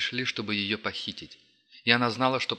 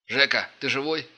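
calling out; 5 semitones higher, 5 dB louder than usual. Acted. Раздался из-за стены голос.

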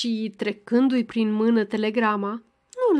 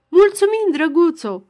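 louder and higher, called from the porch; calling out; 6 semitones higher, 7 dB louder than usual. A narrator's reading. Ghighi calling thanks from the porch, loud and high. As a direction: sing out the thanks across the yard. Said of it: "tocmai din cerdac adăugă".

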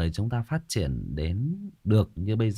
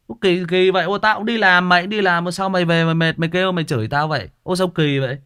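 higher, louder, fast outraged comeback; indignant retort; 5 semitones higher, 4 dB louder than usual. Retold or imitated. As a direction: fire back at him, offended and quick. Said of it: "Con bạn anh nó nói như vậy đó". (giọng bức xúc, cao và to, nói nhanh)

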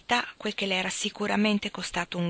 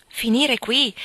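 louder, higher burst of disbelief; shocked exclamation; 4 semitones higher, 8 dB louder, much slower than usual. Acted. Gilbert echoing Helen, louder and higher in disbelief. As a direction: throw the words back at her, stunned. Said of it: "esclamai a mia volta".

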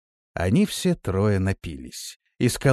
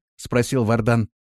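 usual speaking voice

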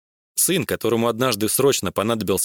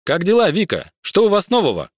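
cheerful greeting; 9 semitones higher, 4 dB louder than usual. Acted.

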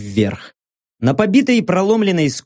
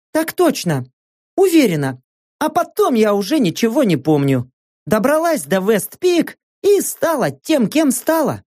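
higher-pitched female character voice; Saka, emotional companion; 6 semitones higher than usual. Acted. Saka telling narrator about the island's past, higher earnest voice. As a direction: voice an earnest woman recalling old news, pitched up.

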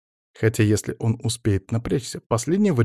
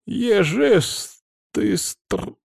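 hesitant granny; 10 semitones higher, much slower than usual. A narrator's reading. Granny struggles for word.

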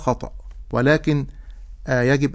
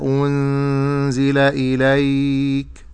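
slow melodic recitation of the verse; Quran recitation; much slower than usual. Acted. Sheikh reciting the whole verse on request. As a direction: chant the verse slowly with tajweed. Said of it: "الآية من أولها".

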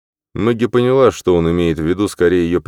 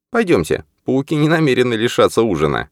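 higher female character voice; Maggie voice; 8 semitones higher than usual. Acted. Maggie's lines in the story.

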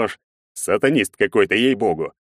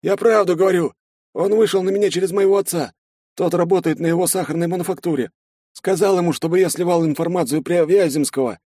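much higher, timid soldier's voice; a scared young sergeant answering; frightened soldier; 10 semitones higher than usual. A narrator's reading. Soldier explains, high voice. (испуганный солдат, высоким голосом)

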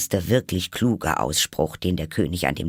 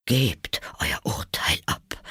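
shaken whisper; 5 semitones higher, slower than usual. Acted. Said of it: "sagte er leise und mit zitternder Stimme".

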